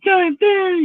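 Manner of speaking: high, loud drawn-out mimicry; mocking imitation. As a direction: mimic it in a squealed wail. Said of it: "like, the way I said it".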